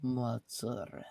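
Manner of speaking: "Mozzarella" drawn out slowly and softly. Shown as a demonstration. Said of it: "Say it again slower".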